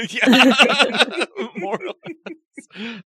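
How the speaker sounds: high, laughing reply; giggling while answering. Real, not acted. GM laughing at the joke.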